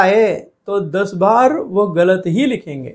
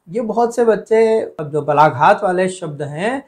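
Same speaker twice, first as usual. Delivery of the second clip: ordinary talk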